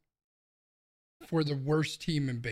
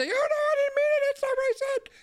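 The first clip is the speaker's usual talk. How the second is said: much higher squeaky mock voice; mocking whiny backpedal; 4 dB louder than usual. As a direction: mimic a whining coward, high and thin. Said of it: "backpedal like a fucking little tiny girl".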